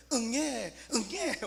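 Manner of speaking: high mock infant cry; baby wail. Preacher imitates a newborn crying, high mock wail. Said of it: "그냥 응애만 하면 돼".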